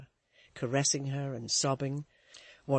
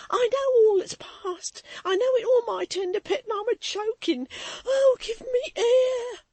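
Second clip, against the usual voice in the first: choking sobs; 9 dB louder than usual. A narrator's reading. Maid sobbing to Florence, crying high and loud. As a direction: gasp it out through tears. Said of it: "cried Susan".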